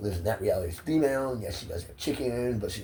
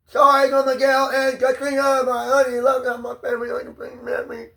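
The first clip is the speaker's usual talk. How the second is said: pleading apology; 10 dB louder than usual. Real, not acted. Apologizing to his family, a high pleading voice. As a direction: beg them to forgive you.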